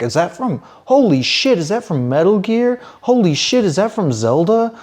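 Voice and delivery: high-pitched awestruck exclamations; amazed gushing. Acted. Player spotting cool Japanese flags in a game, excited amazement. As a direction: geek out, voice leaping up on each "holy shit".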